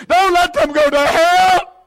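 high, loud pleading prayer; fervent plea. Real, not acted. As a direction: cry out to God in fervent prayer.

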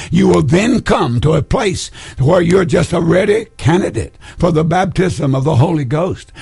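ordinary talk, nothing marked

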